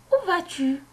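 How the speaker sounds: plus haut, ton de question marqué; question modèle. Shown as a demonstration. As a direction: montre bien que c'est une question. Relit la question exemple. (question lue plus haut)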